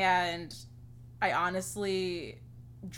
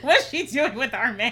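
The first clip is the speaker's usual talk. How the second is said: louder words through laughter; laughing; 3 semitones higher, 11 dB louder than usual. Real, not acted. Joking with a friend about Gemini, laughing. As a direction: giggle out the mock complaint.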